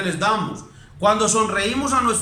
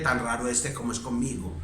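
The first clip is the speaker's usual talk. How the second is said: lower, softer bewildered muttering; puzzled parent complaint; 9 semitones lower, 9 dB quieter than usual. Retold or imitated. Parent complaining their son is cold, muttered low. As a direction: grumble about a cold son. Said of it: "y después dicen".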